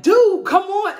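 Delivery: Kelly yelling at the rapper to run; panicked yell. Retold or imitated. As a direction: hurry him along, yelling. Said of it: "And she's like".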